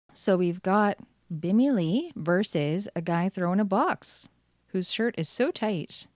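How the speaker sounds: usual speaking voice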